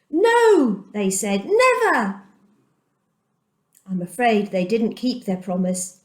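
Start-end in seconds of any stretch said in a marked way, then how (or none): 0.1-0.8 high, louder no from the people; eager crowd reply. Acted. The people answer Joshua. 1.5-2.2 eager crowd reply. Acted. The people answer Joshua.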